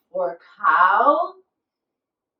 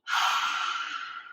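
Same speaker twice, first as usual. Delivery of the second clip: long voiceless huff with the tongue out; playful dragon breath for kids; dragon fire breath; 6 dB quieter than usual. Pretend. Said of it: "we're gonna blow fire with our tongues out".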